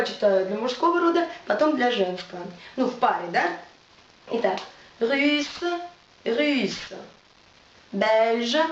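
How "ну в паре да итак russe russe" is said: ordinary talk, nothing marked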